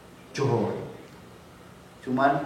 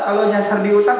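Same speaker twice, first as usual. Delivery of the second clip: ordinary talk, nothing marked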